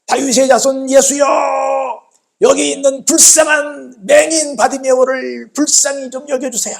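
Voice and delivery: high, drawn-out pleading call; crying out. Retold or imitated. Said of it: "그래가지고 외칩니다".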